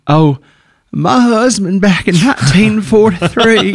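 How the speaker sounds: put-on high, slow Southern old-lady drawl; little Southern old lady. Host imitating Peyton's act, high Southern old-lady voice. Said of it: "she just does this little Southern old lady".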